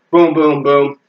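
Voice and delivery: casual, evenly spaced booms; spoken impact noise at an ordinary pitch; unhurried punch sounds. Shown as a demonstration. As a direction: toss off plain punch sounds, one by one. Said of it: "is not just, oh, you know".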